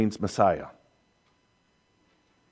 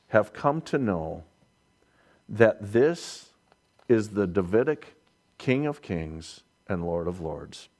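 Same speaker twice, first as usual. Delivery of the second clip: ordinary talk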